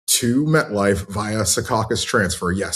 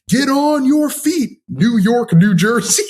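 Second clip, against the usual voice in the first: high, loud, slow hype call from the arena speakers; stadium announcer; 5 dB louder, slower than usual. Retold or imitated. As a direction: boom it out like a stadium PA pumping up the crowd. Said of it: "they were doing things like".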